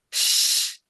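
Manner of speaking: a voiceless, drawn-out শ hiss; shin sound. Shown as a demonstration. Teacher sounding out the letter শিন for students. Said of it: "এটা শিন".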